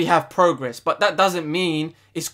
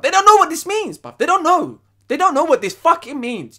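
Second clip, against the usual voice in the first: angry shout; 9 semitones higher, 5 dB louder than usual. Real, not acted. Fan ranting about his team.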